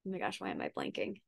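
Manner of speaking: very low, hushed, quick aside; talking to oneself. Real, not acted. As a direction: drop far down, as if thinking aloud. Loses her thread, asks herself.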